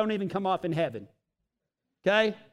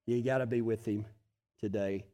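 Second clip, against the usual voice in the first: soft repeat; 10 semitones lower, 7 dB quieter than usual. Shown as a demonstration.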